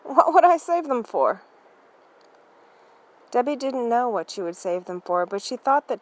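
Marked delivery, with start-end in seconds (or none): 0.0-1.4 higher, louder scornful scoff; snorting dismissal, higher and louder. Acted. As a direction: brush off the idea with a snort. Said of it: "said with a snort".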